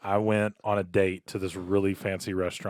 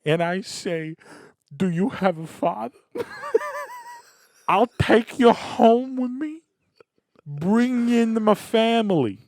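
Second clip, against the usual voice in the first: Italian dad; 9 semitones higher than usual. Pretend. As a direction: scold the date like an old-school Italian dad. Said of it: "is this how Italian men".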